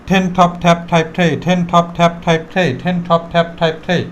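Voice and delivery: level rattled-off drill, puffed T on each word; in one breath. Shown as a demonstration. Teacher shows saying it three times, in one breath. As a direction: chain the list round without pausing. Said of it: "একদমে".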